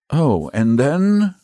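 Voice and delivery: high, slow echo of the question; stalling echo. Acted. Saint George gropes for what follows, stalling. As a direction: stretch it out while searching for an answer.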